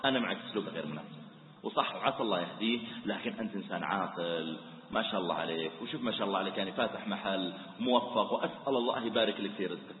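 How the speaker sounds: soft friendly coaxing. Shown as a demonstration. Preacher showing how to befriend the shopkeeper, soft and coaxing. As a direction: soothe him like a friend. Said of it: "ثم تقول له".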